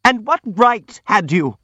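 high, slow, strained squeal; unnatural squeal. A narrator's reading. Hippolyte challenges the prince's right, squealing unnaturally. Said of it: "squealed Hippolyte in a most unnatural voice".

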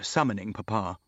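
usual speaking voice

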